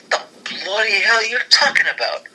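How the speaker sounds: light British impression; mock British accent. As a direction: snap it out like an irate Brit. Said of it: "And Regal, he was just like".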